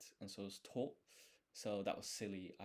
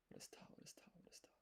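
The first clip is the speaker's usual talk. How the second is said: what is ta repeated under the breath, much softer; muttering it over and over, searching memory; muttering to self; 21 dB quieter, much faster than usual. Real, not acted. Learner quizzing self on katakana, softly muttering. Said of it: "I'm just trying to jog my memory".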